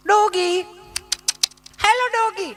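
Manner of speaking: high, sing-song coaxing; mincing tourist calling dog. Retold or imitated. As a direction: coo to the dog like a posh lady. (કૂતરીને લાડથી બોલાવતી બેન)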